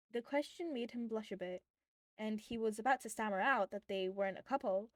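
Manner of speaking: ordinary delivery, nothing marked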